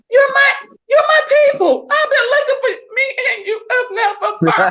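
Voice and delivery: high, laughing, near-crying outburst; choked-up happy cry; tearful joy. Retold or imitated. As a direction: gush like you finally found your people. Retelling joyful first meeting.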